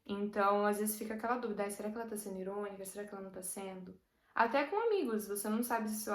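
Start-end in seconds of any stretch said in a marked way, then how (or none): 1.6-4.0 fast, much softer musing to oneself; wondering quietly, half to yourself; doubtful inner murmur. Retold or imitated. Voicing inner doubt about irony. (dúvida murmurada, rápida e baixinha)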